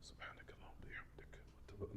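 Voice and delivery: soft unvoiced whisper of the prayer; barely audible prayer whisper. Shown as a demonstration. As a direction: whisper it to yourself. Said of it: "You, you whisper it to yourself".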